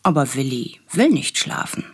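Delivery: ordinary delivery, nothing marked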